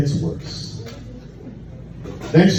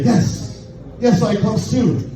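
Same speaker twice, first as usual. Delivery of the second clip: fake eager yes; 5 semitones higher, slower than usual. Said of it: "And I lie. I say".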